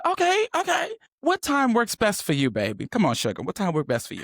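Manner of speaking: high, syrupy fake-sweet "baby, sugar"; mock sugary sweetness. Texting her ex with mocking honeyed sweetness. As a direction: coo it at him with sarcastic sweetness, pitched high. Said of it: "So I said".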